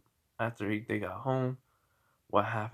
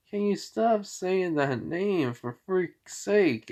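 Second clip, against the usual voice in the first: higher, slow exasperated complaint; acted exasperation; 7 semitones higher, slower than usual. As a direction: play fed up, stretching the words out high. Fed up with old name.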